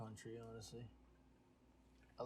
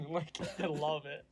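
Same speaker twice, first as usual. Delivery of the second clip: laughing, higher-pitched delight; amused laughter; 5 semitones higher, 3 dB louder than usual. Real, not acted. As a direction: crack up while saying how much you enjoy it. Laughing at own impression of friend.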